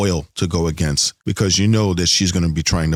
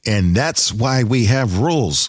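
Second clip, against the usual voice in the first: imitating Janeway; 5 semitones higher, slower than usual. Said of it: "And she's like".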